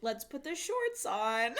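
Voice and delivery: high, lightly voiced sweet mom; a hint of a coaxing mother. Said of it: "the mom's like".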